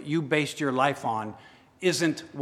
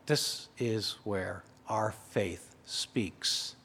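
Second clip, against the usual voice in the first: much softer, slow and lower, almost under the breath; hushed; 5 semitones lower, 10 dB quieter, much slower than usual. Shown as a demonstration. Preacher restating the line to the congregation, hushed and slow. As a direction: drop to a slow hush so the room leans in.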